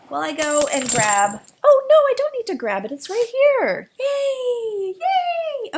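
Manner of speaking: high, loud, squealing delight at the dog. Real, not acted. Cooing at the waking dog in excited baby talk. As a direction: squeal it up to the puppy.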